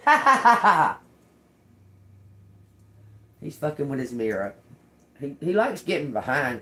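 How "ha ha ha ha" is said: laughing. Real, not acted. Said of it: "I can make him laugh".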